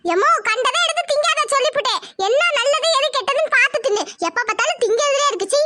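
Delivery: scolding. Acted. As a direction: scold them for eating everything. Scolding someone about eating. (திட்டும் குரல்)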